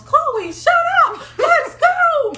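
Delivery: mother yelling at the screaming sister to go; mock mom yell. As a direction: bark it like a fed-up mother.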